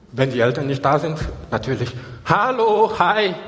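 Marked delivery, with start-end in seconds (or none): 2.2-3.3 raised, higher-pitched greeting; acting out children calling hello. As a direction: hail a passer-by from the balcony. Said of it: "sie werden laut rufen".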